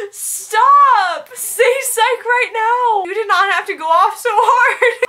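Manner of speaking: loud, high, drawn-out shriek; overwhelmed disbelief. Real, not acted. Vlogger opens subscriber's surprise gift, shrieking in disbelief. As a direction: shout in stunned delight, stretching the words.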